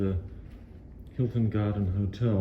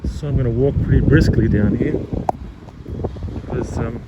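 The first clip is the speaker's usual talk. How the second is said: louder to beat the wind noise; speaking over wind; 2 semitones higher, 7 dB louder than usual. Real, not acted. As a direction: raise your voice against the wind. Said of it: "it's a bit windy today".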